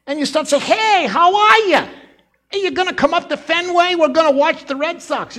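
loud, high, pointed Boston accent; nasal Boston accent. Shown as a demonstration. Preacher mimics a Red Sox fan greeting someone, nasal Boston accent. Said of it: "little more nasal and a little more pointed".

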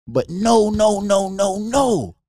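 shouted no. Real, not acted. Emphatic denial in sermon-like talk.

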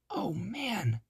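put-on boy whispering in relief after hiding; whispered. A narrator's reading. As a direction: breathe it so nobody hears. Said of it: "whispered Jack".

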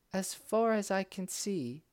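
light, high princess voice; bright young lady speaking. A narrator's reading.

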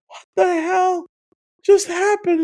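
mock stunned outrage; stunned disbelief. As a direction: blurt it out in shock. Disbelief after character rushed off.

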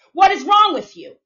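loud, high exasperated demand; exasperated shout. Real, not acted. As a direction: shout it at them in disbelief. Exasperated rant at believers.

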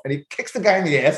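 usual speaking voice

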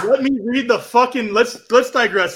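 high, loud and eager; can't wait to read it; eager to read the festival lineup; excited. Real, not acted.

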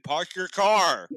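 slow, loud, every R pronounced in Park your car; Park your car, each R said clearly and slowly; careful non-Boston R's. Retold or imitated. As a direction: spell out the R's for an outsider. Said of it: "then they'll be like, "What'd you say?".

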